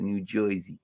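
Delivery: "Jersey" said as "Joisey" in New York accent. Shown as a demonstration. Streamer showing what they almost said, New York accent. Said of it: "I almost said it".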